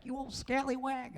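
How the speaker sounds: put-on a quick high old-man jab. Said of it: "that old man from the other side".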